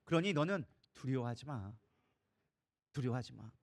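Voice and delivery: low, soft, fatherly-calm reasoning; God's gentle reassurance. Acted. God telling Joshua not to fear, gentle reassurance. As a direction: settle them down, quietly and firmly. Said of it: "하나님이 얘기하는 거예요".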